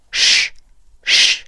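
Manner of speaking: "sh" as pure air with pushed-out lips; voiceless "sh". Shown as a demonstration. Teacher showing learners a phonetic symbol, voiceless "sh". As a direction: pout and blow "sh", no voicing. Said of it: "注意嘴巴是往前撅的".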